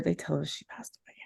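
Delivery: barely voiced, hushed whisper. Real, not acted. Telling listeners her friend died, a soft whisper. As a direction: let the news drop to a breathless whisper.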